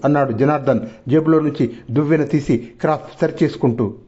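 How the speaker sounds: usual speaking voice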